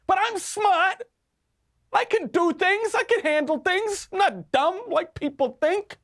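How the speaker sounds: very high, indignant Italian American protest; Fredo impression. Commentator repeating the Fredo act to mock an anchor, high-pitched impression. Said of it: "almost word for word his response".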